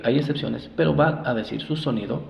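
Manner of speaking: ordinary talk, nothing marked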